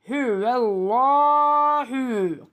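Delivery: "Hü vellahu" chanted slowly with a heavy, long "llaa"; thick lam recitation. Shown as a demonstration. Teacher explaining üstün, "Hü vellahu" with thick lam. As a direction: draw out "Hü vellahu", lam full and dark. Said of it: "kalın oluyor".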